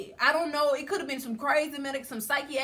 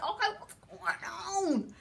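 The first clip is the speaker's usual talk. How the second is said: confused; 6 semitones higher, slower than usual. Retold or imitated. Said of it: "now I'm confused".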